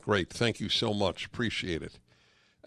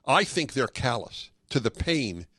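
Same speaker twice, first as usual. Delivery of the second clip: normal talking voice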